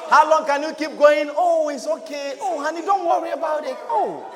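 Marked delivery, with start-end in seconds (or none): 1.4-4.2 fake sweetness. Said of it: "How long can anything go with you?".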